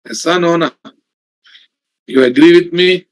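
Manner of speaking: ordinary talk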